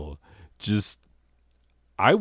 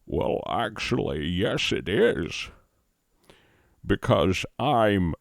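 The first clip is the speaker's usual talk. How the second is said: dead actor's voice. Acted. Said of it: "you're portraying a man who is now dead".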